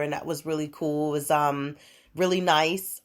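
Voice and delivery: ordinary delivery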